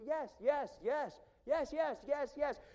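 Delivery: eager yes. Real, not acted. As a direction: burst out saying yes to everything. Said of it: "a person that says yes to everything".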